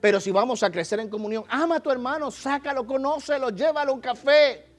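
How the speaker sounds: loud, high sermon push; rally the congregation from the pulpit; full-force preacher; fervent preaching. Acted.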